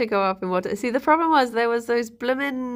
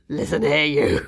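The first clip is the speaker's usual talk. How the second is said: stern; 6 semitones lower than usual. Retold or imitated. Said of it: "I would have been like".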